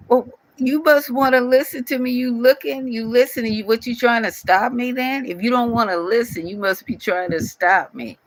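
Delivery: high, sharp scolding of a heckler; indignant retort. Real, not acted. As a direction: put him in his place, high-pitched. Scolding a commenter on his page.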